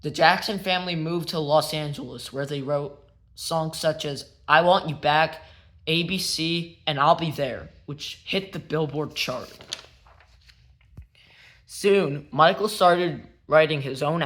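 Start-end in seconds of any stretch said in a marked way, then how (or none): none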